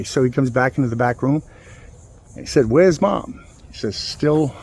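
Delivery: ordinary delivery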